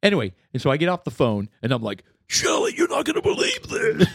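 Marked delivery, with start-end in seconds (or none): 2.3-4.1 excited. Retold or imitated. Excitedly telling wife the news.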